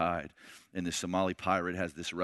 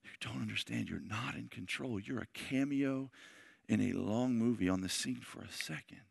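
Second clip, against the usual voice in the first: much softer, tender pleading; soft begging; 6 dB quieter than usual. Retold or imitated. David begging the listener to surrender to Jesus, soft plea. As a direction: quietly plead with them to give their life. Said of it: "David's begging you".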